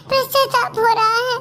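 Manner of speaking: ordinary talk, nothing marked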